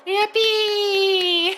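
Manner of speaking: high, loud hammed-up cheers; mock cheering. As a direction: overdo the glee like a cartoon. Acts out fantasy-time cheer.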